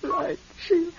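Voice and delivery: soft, crying surrender; giving in through tears; tearful. Acted. Joyce tearfully submitting to her dead sister Sheila.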